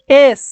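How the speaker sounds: "এস" said loud as "es", the e clearly heard; sounding out S. Shown as a demonstration. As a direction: punch "এস" to show it begins with e. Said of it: "এইটাকে যদি উচ্চারণ করো".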